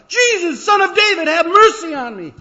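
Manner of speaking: all-out pleading yell; desperate holler. Acted. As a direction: scream for mercy over everyone. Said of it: "makes you holler all the more".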